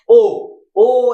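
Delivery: ordinary talk, nothing marked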